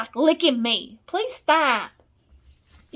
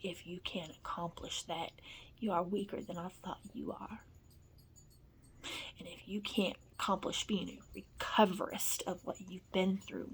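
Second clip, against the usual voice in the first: low, breathy whispered scolding; whispered lecture, barely voiced; harsh whisper; 5 semitones lower, 15 dB quieter than usual. Real, not acted. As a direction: hiss the threat under your breath. Intense whispered lecture to pets.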